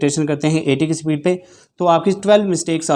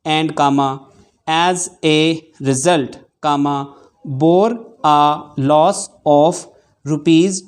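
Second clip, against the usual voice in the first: very slow dictation with long pauses between phrases, punctuation spoken aloud; measured dictation pace, saying "comma" and "full stop"; much slower than usual. Shown as a demonstration. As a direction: dictate word by word for shorthand students. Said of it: "तो ये हमारी थोड़ी सी तेज़ speed थी".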